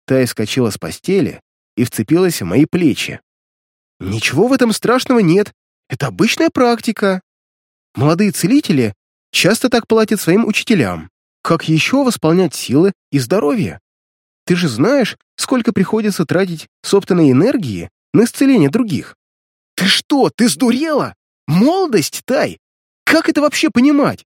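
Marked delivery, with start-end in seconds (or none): 4.0-19.2 put-on higher girlish voice, urgent; calm him down like a worried sister; young girl pleading. Sister explains, reassuring brother. 19.8-24.2 put-on higher, outraged young man; angry. Brother outraged at sister.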